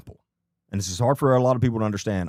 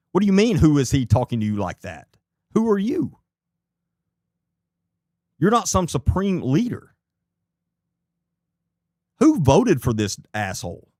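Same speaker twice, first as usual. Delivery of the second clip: angry rant; 6 semitones higher than usual. Real, not acted. Ranting at mayor's behaviour.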